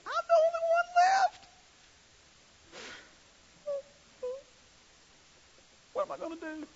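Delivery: mock a sulky prophet feeling sorry for himself; whiny self-pitying Elijah. Preacher mimics Elijah as depressed and self-serving, whining. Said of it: "a bit depressed and a bit self-serving".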